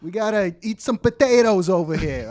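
loud mock East Coast accent; East Coast Irish-Italian. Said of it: "everyone on the East Coast Italian".

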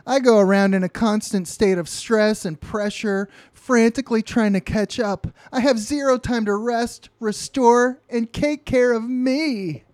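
hammed-up frazzled plea, high and loud; acted pleading. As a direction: plead loudly in an overwrought high voice. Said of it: "she's pleading with the guy".

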